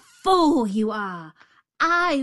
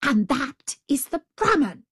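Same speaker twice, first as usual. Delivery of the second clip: higher, emphatic pointing out; exasperated tiger; 7 semitones higher, 4 dB louder than usual. A narrator's reading. Tiger teaching the jackal who is who, exasperated. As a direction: spell it out impatiently. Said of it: "in a rage".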